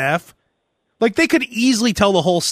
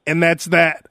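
imitating boss; 6 semitones lower than usual. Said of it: "your program director's like".

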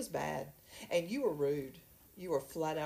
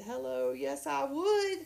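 high, slow, sassy hello yes I would; a playful mock coy acceptance; eager yes; 8 semitones higher, slower than usual. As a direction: draw it out, delighted and flirty. Retold eager acceptance of a date.

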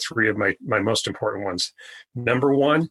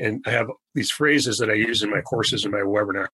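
ordinary delivery

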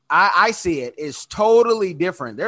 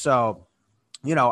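normal talking voice

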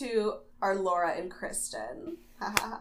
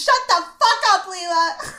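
much higher, louder mock yell; 10 semitones higher, 7 dB louder than usual. Mocking Kristen screaming at Leela, shrieking loud. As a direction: scream it at Leela over the top.

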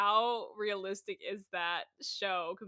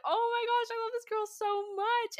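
gushing; 13 semitones higher than usual. Retold or imitated. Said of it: "and I was like".

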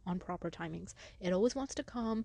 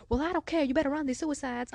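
high, loud mock scream in a southern accent; screaming coach; 7 semitones higher, 6 dB louder than usual. Coach refusing the student's plea, screaming. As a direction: imitate a coach yelling an order. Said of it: "She screams".

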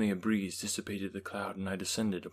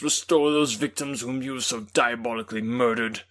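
raging, loud and higher throughout; furious detestation; 5 semitones higher, 10 dB louder than usual. A narrator's reading. Victor confronting his creature on the glacier, raging loud. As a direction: pour out hatred at the top of the voice. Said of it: "words expressive of furious detestation".

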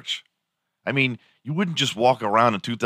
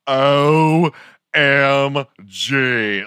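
loud, high, drawn-out outburst; mock-blaring, stretched-out exclamation; very loud OMG; 6 semitones higher, 6 dB louder, much slower than usual. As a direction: blare it out like over an intercom. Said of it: "it was so fucking loud".

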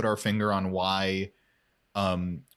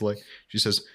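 usual speaking voice

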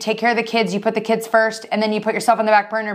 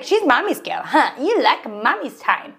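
slow, cooing mock baby voice, laughing; imitated baby talk; slower than usual. Friend quoting the mother cooing at dinner, baby talk. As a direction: coo it like she did. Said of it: "She's like".

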